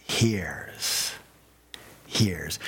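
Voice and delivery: "Hears" said low and falling, like a correction; stressed "Hears". Shown as a demonstration. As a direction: land firmly on "Hears".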